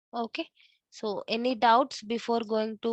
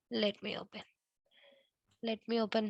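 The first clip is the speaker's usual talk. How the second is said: much softer mutter to self; barely audible; 9 dB quieter than usual. Real, not acted. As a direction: murmur it under your breath. Muttering while opening a document.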